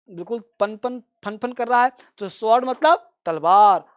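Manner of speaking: ordinary talk, nothing marked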